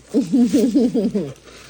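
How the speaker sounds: loud closed-mouth chuckling; giddy giggle. Real, not acted. Giddy while eating.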